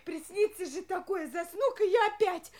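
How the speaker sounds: usual speaking voice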